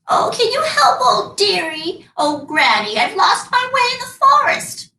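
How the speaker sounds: put-on high, cracked old crone voice; old beggar woman. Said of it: "poor old beggar woman".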